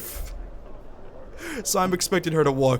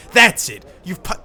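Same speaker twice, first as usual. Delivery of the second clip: louder, higher furious snap; the thug explodes at the party; sudden angry shout; angry outburst; 5 semitones higher, 7 dB louder than usual. Acted. Angry thug starts a fight.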